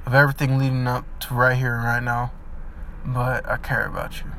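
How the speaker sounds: soft, hushed, sincere words of care; quietly telling them you still care; tender forgiveness. Pretend. To the person who hurt them, soft and tender.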